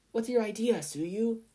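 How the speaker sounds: put-on girl asking a teammate for a plan; eager girl voice. A narrator's reading. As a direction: ask keenly, scheming along. Habuko voiced in footage.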